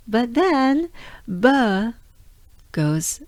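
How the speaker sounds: usual speaking voice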